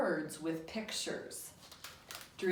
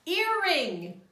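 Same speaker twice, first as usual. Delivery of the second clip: much higher and louder; Earring, high and loud; excited answer; 9 semitones higher, 9 dB louder than usual. Shown as a demonstration. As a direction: praise the correct word. Teacher cheers the answer.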